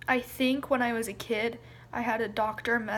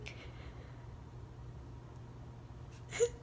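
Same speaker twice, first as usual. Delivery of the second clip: quiet high sob; real, breathy sobbing; crying; 12 semitones higher, 12 dB quieter than usual. Real, not acted. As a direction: let a soft sob escape. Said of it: "they just kinda came out".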